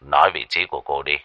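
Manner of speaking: low, firm reply. A narrator's reading. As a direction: drop the voice and demand an answer. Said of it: "trầm giọng đáp lại từng chữ".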